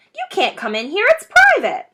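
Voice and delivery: a rat guarding his hole; snapping rat. Acted. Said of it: "it snapped".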